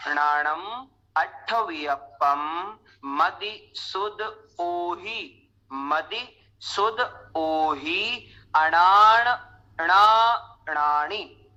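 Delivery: नाणम अट्ठवियप्पम spelled out slowly, each syllable separate. Shown as a demonstration. Teacher splitting the verse slowly to show pronunciation. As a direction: break अणाण णाणाणि into slow separate syllables. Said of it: "उच्चारण एक बार इसको अलग अलग समझें".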